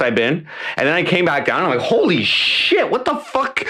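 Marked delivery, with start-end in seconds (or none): 1.9-3.6 astonished exclamation. Retold or imitated. Amazed reaction to trip coming down.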